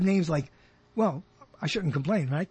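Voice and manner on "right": ordinary talk, nothing marked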